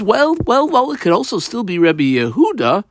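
a sly well, well, well rising high; voicing the Gemara. The Gemara answering back to the student, high and playful. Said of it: "The Gemara says".

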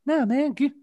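squeaky, very high impression that cracks; a Tyson voice gone far too high; terrible Mike Tyson. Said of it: "I'm gonna do my terrible impression again".

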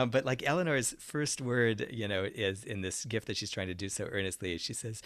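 normal talking voice

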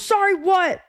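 high, demanding parental prompt; imitated scolding. Dad prompting crying son, imitated scolding.

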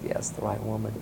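hushed, unvoiced whisper; whispered reply. Mother answers Oprah on live TV, whispering. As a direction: breathe it out, barely voiced.

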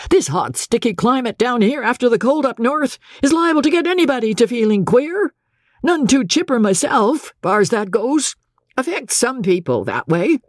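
put-on high, folksy old captain; high-pitched, chatty defence; Captain Andy explaining away. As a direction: make excuses breezily in a high voice.

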